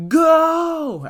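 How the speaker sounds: high, loud starting shout. Acted. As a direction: bark the start of the fight.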